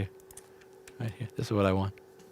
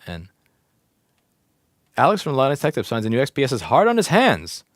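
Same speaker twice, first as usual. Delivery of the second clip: normal talking voice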